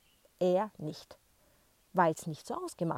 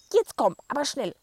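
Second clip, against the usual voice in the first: loud, high urging call; excited call; 8 semitones higher, 11 dB louder than usual. Acted. Grandma calls the boy over excitedly, loud and high. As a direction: wave him over, urgently. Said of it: "ruft sie und winkt aufgeregt".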